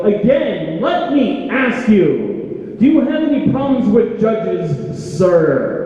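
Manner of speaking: high, booming echoey border guard question; echoing official voice. Retold or imitated. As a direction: boom it out like a voice echoing in a hall. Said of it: "That's exactly how it sounded with all that echoing".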